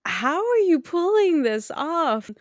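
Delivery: really wow at it, stretching "seriously"; amazed. Real, not acted. Reacting to a co-host's stunt story, loud and amazed.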